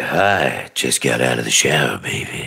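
low, sultry come-on sinking into a whisper; phone sex voice. Pretend. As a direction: pretend seduction down the phone. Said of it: "a phone sex thing".

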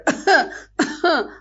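choking coughs from the smoke; a burst of high, spluttering coughs; coughing fit. A narrator's reading. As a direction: cough as if smoke fills your lungs. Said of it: "అందరూ పొగకి దగ్గటం మొదలెట్టారు".

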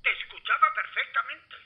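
high, sharp angry retort. Acted. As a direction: snap back in irritation. Se queja del grito por radio. (enfadado y agudo)

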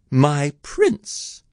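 high, dismayed musing; lightly voiced high lament; Cindy thinking. A narrator's reading. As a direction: think aloud as heartbroken Cindy. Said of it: "she thought".

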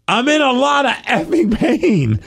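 slow, high strained yell; yelling in pain. Retold or imitated. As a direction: shout it out in pain. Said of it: "I just yelled it outside".

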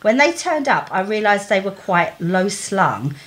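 normal talking voice

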